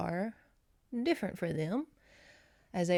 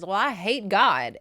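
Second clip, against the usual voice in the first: louder, slow defiant declaration; mock defiant teen, a bit louder; defiant outburst; 7 dB louder, slower than usual. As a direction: spit it out like a rebellious teen.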